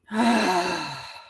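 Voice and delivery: long falling exhale; releasing sigh; deep sigh. Real, not acted. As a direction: sigh the breath out. Exhales after deep breath cue.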